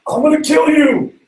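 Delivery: high, loud mock threat. Brothers answering Joseph, high and loud in anger. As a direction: bark it as an angry brother. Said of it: "And they're like".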